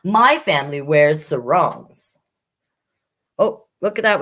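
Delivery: usual speaking voice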